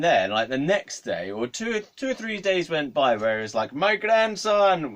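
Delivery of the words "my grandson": high, loud, drawn-out greeting; hammed-up cry of recognition; effusive old man. Old man greeting his supposed grandson, effusive.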